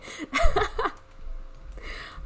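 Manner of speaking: loud, high burst of real laughter; helpless laugh. Real, not acted. Streamer apologizing after own joke, helpless high laughing. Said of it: "I made myself laugh way too hard".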